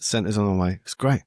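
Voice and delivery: ordinary delivery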